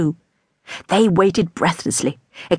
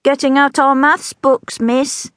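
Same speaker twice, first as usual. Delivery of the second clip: higher, slow dutiful answer; dutiful child; 8 semitones higher, slower than usual. A narrator's reading. Pupil answering the teacher dutifully, higher and slow.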